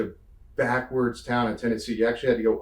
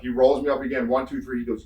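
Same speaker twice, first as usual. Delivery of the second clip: ordinary talk, nothing marked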